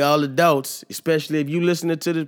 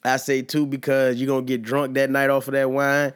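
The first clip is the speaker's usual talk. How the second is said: ordinary delivery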